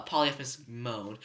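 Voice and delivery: ordinary delivery, nothing marked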